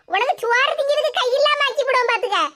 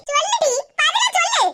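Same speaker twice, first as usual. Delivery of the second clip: angry demand; 5 semitones higher than usual. Acted. Quarrel between neighbours.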